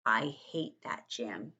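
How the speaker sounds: low, slow grumble; heavy, deliberate grudge; grudging dislike. Acted. Narrator voices Junie's dislike of Jim.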